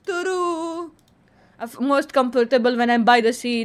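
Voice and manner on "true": high, sustained sing-out answer; drawn-out verdict. Pretend. Answering a personality quiz, high and drawn-out. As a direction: stretch the vowel on one held note.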